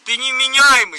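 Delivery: loud, heated accusation; angry shout. Real, not acted. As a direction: shout it at him in outrage. Quarrel while being forced into car.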